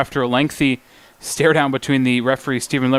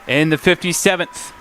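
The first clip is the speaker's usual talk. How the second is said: live play-by-play; 5 semitones higher, slower than usual. Real, not acted. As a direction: pitch climbing to a high peak at the save, then easing.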